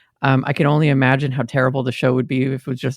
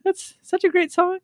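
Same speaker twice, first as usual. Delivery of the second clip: put-on very high cooing baby talk. Shown as a demonstration. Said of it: "randomly start talking in a baby voice".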